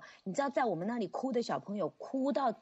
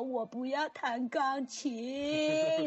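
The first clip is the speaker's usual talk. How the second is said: put-on whiny child; 4 semitones higher, much slower than usual. Child refusing piano again.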